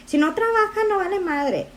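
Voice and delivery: imitating Mexican family. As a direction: quote the family's disapproval in a high mocking tone. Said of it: "how the Mexican families are like".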